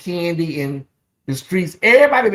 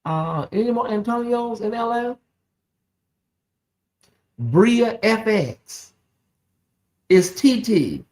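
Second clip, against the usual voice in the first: ordinary delivery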